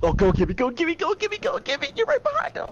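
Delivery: high, rising cheers for Gibby; excited cheering. Real, not acted. Cheering teammate on during chase.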